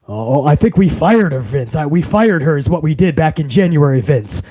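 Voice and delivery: low, meek yes-man putting on authority. Acted. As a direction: deepen it to sound official and professional. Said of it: "with my deeper voice".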